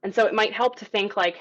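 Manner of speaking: ordinary talk, nothing marked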